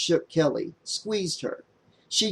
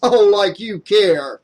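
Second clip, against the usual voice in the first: mocking jeer; 6 semitones higher, 8 dB louder, slower than usual. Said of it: "a cackle from Miguel".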